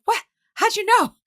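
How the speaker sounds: playing caught-out shock, voice jumping up high; mock surprise. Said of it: "I'm like".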